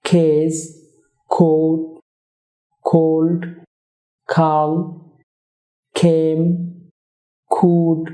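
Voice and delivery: খার্ড খোল খ্যাপ each begun with a puffed k; aspirated k words. Shown as a demonstration. Teacher drilling k-initial words for viewers. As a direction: breathe out on every opening k. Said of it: "ওয়ার্ডের শুরুতে খেই sound".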